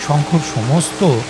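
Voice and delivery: usual speaking voice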